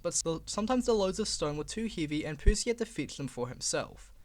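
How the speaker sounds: ordinary delivery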